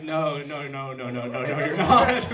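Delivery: string of no's building to a loud finish; emphatic mock denial. Escalated repeat of the dismissal.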